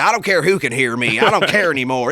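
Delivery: loud, defiant parent in the stands; acted-out ranting sports dad. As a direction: raise your voice so everyone around hears. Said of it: "It's called The Loud Dad".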